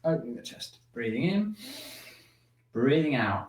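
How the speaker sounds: slow, soft breathing cues; guided breathing. Shown as a demonstration. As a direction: lead the breath gently, drawn out.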